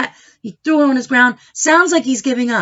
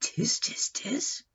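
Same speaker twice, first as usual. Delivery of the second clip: soft voiceless monkey tsk; whispered monkey chatter; 14 dB quieter, slower than usual. Acted. Monkeys mocking the peddler from the tree in a whisper. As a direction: hiss it like a cheeky monkey.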